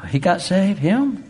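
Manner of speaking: high, incredulous mock gasp; mock disbelief. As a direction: act amazed that he of all people got saved. Imitating surprised doubters.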